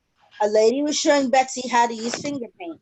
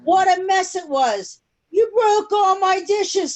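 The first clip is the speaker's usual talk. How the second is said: upset cry; 7 semitones higher than usual. A narrator's reading. Lunch worker scolding after the crash, upset cry. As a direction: wail over the broken dishes. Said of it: "someone cried".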